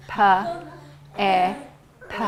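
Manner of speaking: "P, E, P" as puh, eh, puh; sounded out. Shown as a demonstration. Teacher trying the three sounds again, puh, eh, puh spaced out. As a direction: isolate each sound, pausing between. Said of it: "Those are the three sounds in pep".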